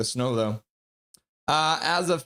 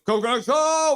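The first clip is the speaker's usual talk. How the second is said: much higher and louder shouted cheer; 12 semitones higher, 5 dB louder than usual. Acted. Fan cheers the team on, shouting the chant. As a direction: yell it out like the crowd.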